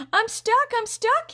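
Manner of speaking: loud, very high frightened cries; panicked yell. A narrator's reading. Larry yelling for help with his shoe stuck. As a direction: cry out in panic, caught fast. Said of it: "he yelled".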